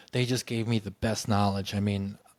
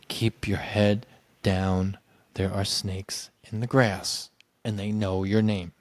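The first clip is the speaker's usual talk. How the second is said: low, slow and measured; ominous warning; 4 semitones lower, slower than usual. Retold or imitated. Fired director warning teacher by phone, low and slow. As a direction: space the words out like a grave caution.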